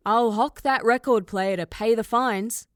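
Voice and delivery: loud, gruff mock dad threat; a hint of an angry father; imitating dad. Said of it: "His exact words had been".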